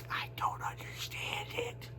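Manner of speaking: unvoiced whispered aside. Real, not acted. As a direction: breathe it out to yourself, baffled. Aside after baffled rant.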